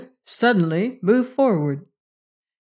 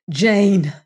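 anguished, raised-voice appeal; grieving outcry; despairing grief; 5 dB louder, slower than usual. A narrator's reading. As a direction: cry out in grief, louder. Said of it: "cried Venters in despairing grief".

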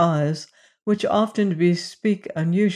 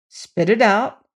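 urging; 5 semitones higher, 4 dB louder than usual. A narrator's reading. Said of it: "urged Syme".

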